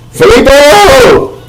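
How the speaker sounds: high, slow drawn-out call; mock calling out. Calling the brand's name out, high and slow. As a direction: call the name across a piazza.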